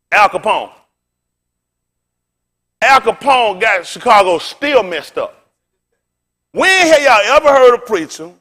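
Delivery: loud, rising pulpit cadence, hammering each question; preaching at full force. Acted. Preacher challenging the congregation about who history calls sinners, fiery and loud.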